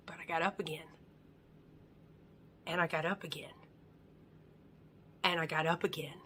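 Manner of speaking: low, soft, with long pauses; hushed, low self-affirmation; quiet resolve. Acted. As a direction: tell yourself softly, steadily. Quiet resolve voiced to oneself.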